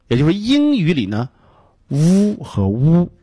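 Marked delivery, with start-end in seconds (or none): 1.9-3.1 first "呜" a buzzing v, second "呜" a rounded w; slow v-versus-w contrast in "呜和呜"; two distinct sounds. Shown as a demonstration. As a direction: keep "呜和呜" apart as v and w. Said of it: "是分开的".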